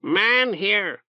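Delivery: high, squawky bird warning; a pet bird croaking an alert; talking bird. Acted. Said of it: "Orb warned them".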